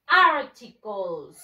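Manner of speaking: articles said slowly and loudly as AR-ti-kols; slow, loud model of articles for the kids to echo; model pronunciation. Shown as a demonstration. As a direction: drill word articles, each syllable clear. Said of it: "Repeat after me".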